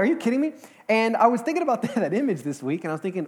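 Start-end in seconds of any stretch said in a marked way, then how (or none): 0.0-0.5 high, disbelieving "are you kidding me"; incredulous. Retold or imitated. Pastor retelling his reaction to the monkey video, incredulous. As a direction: pitch it up in sheer disbelief. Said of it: "Like, I was like".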